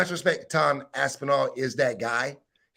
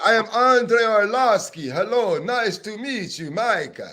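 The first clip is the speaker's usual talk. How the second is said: mock Russian accent; 6 semitones higher, 6 dB louder than usual. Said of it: "start doing the accent and shit".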